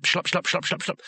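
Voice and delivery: urgent run of squelching steps; speeding-up footsteps. A narrator's reading.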